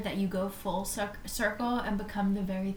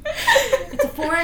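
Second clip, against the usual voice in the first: laughing; 11 semitones higher, 8 dB louder, slower than usual. Real, not acted. Laughing while speaking.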